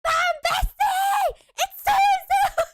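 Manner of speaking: high, slow, stretched shout across the room; teacher voice scream. Retold or imitated. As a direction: yell out in a teacher voice to a friend far off. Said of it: "I would just scream out".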